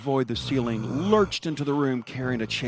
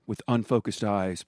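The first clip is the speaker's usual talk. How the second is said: ordinary delivery, nothing marked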